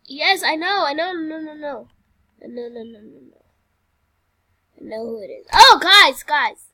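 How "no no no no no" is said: normal talking voice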